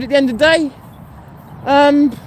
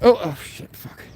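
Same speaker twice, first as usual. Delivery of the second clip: real out-of-breath curse, softer and lower; gasped swearing as breath fails; breathless and low, struggling for air; 6 semitones lower, 5 dB quieter than usual. Real, not acted. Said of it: "God, I'm out of breath".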